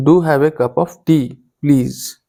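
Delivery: "do" given force at the start of the invitation; emphatic imperative. Shown as a demonstration. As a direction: insist gently by stressing "do". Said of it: "there is an element of force in that".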